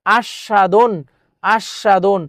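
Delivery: আস্বাদন in pieces, "ash-sha-don"; doubled sh, silent b. Shown as a demonstration. Teacher testing the silent ব ফলা, syllable-by-syllable আস্বাদন. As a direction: split আস্বাদন on a held "sh", no b. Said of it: "ব ফলার উচ্চারণ হচ্ছে না".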